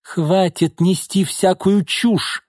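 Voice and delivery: high, drawn-out exasperated cry; shouting angrily. A narrator's reading. Mother dismissing the young woman's words, shouting angrily. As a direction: shut her up, stretching the words. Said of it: "крикнула Бакшеева".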